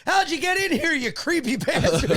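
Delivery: high put-on voice, laughing at the end; movie character quote. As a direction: play the indignant movie guy. Acts out a movie scene quote.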